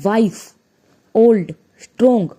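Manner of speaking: वाइस, ओल्ड, स्ट्रॉन्ग each as one separate beat; one-syllable demo of वाइस, ओल्ड, स्ट्रॉन्ग; one sound per word. Shown as a demonstration. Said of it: "एक ही sound में हम उसको बोल रहे".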